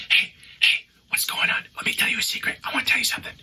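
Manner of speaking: hushed secret-sharing whisper; confiding, unvoiced whisper. Shown as a demonstration. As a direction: whisper to coax a screaming child. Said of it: "you just start whispering".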